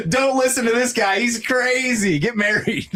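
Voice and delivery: high, laughing mock voice; mock contrarian voice. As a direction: tease like a different guy. Mocking voice against himself.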